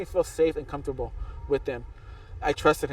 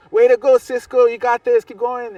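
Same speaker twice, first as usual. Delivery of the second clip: raised-voice rooting, shouted as a retold yell; yelled encouragement; 8 semitones higher, 9 dB louder than usual. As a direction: imitate a loud, high cheer from a passing car. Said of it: "he yelled".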